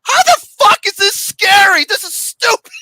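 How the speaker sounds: loud, high disbelieving yell; incredulous shout. Retold or imitated. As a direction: shout it like it's ridiculous. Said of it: "I'm like".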